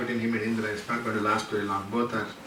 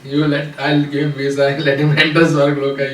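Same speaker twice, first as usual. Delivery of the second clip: louder, laughing through the offer; loud bargaining; 10 dB louder than usual. Acted. Indra bargaining with Vishwamitra over the visa, loud and laughing. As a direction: push the compromise out loudly with a laugh.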